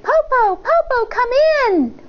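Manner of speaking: put-on high excited child voices; high, eager child calling; excited children. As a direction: cheer like eager little kids. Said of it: "They shouted".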